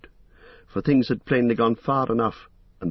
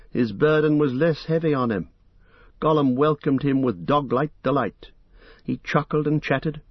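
usual speaking voice

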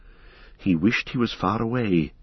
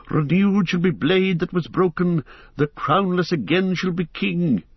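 bursting recitation; 8 semitones higher than usual. Acted. Bilbo defends his friend to Boromir, excitedly reciting. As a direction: recite the poem as an outburst. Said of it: "he burst out".

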